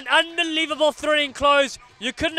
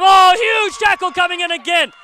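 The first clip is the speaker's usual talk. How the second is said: excited call; 5 semitones higher, 8 dB louder than usual. Real, not acted. Big tackle call.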